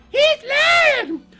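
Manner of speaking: put-on very high, loud little-girl falsetto; shrieked accusation. Little Red exposing wolf's lie, high shriek. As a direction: screech it from inside a sack.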